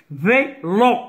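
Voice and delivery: Vélo in two separate beats, vé, then lo; syllable by syllable. Shown as a demonstration. Teacher counting syllables for pupils, Vélo split in two. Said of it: "vélo se prononce de deux syllabes".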